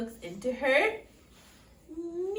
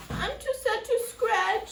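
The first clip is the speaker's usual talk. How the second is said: high, louder plaintive whine; a storybook wail of sorrow; mock sadness; 7 semitones higher, 5 dB louder than usual. A narrator's reading.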